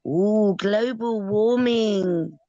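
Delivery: mocking alarmists. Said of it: "when they're telling us".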